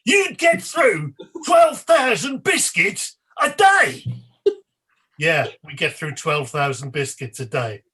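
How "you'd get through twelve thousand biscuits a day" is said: slow, high, shouted echo; hammed-up head-injury presenter shout; much louder impression. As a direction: bellow it back in slow disbelief, word by word. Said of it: "in a much louder and like".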